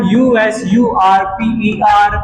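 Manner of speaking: letters spelled out slowly one by one; slow spelling. Shown as a demonstration. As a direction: spell each letter clearly for the class. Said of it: "spelling समझ में आ रही बेटा".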